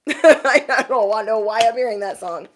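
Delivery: amused, laughing disbelief. Real, not acted. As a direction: laugh through the words, amused at yourself. Laughs at song in her head.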